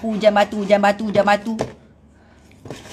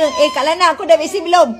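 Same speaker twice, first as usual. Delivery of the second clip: mock nosy follower; 6 semitones higher, 4 dB louder than usual. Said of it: "Baik, kau tanya".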